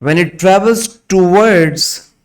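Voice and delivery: slow, word by word dictation; dictating. Acted. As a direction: dictate slowly for students to write. Dictation continues.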